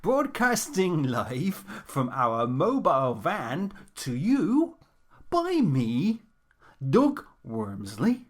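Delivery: radio announcer. Acted. Slow presenter introductions.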